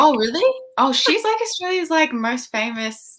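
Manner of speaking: high, loud burst of disbelief; taken aback, pitch and volume jumping up; surprised. Real, not acted. Host amazed her guest didn't know the star is Australian, surprised.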